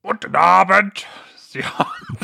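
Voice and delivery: high voice fading into a hissing whisper; snake voice. Acted. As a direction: hiss the words like a snake. S1 voices the S-rank character.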